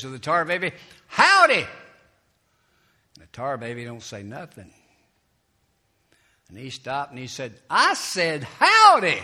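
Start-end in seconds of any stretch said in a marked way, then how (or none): 1.1-1.7 a loud, high, cheery "Howdy!"; friendly shout. Acted. Brer Rabbit greets tar baby. 7.7-9.2 loud, slow, insistent "I said howdy!"; annoyed shout. Acted. Brer Rabbit greeting again, annoyed.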